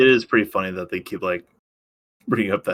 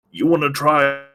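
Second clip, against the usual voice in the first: higher, louder, hammed-up eager offer; mock indulgent dad; 6 semitones higher, 4 dB louder than usual. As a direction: play a dad temptingly offering a treat. Playing a dad offering the car.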